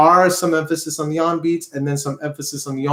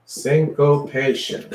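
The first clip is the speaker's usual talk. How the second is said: SYNCOPATION drawn out syllable by syllable; slow model pronunciation; slower than usual. Shown as a demonstration. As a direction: say "Syncopation" slowly, for a class to repeat. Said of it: "Everybody say that".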